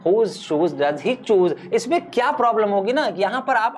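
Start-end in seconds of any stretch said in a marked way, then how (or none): 0.1-1.7 very slow, word by word; slow teacher demo of the twister; slow tongue twister. Shown as a demonstration. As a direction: drag out each word so students can follow. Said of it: "पढ़ते हैं".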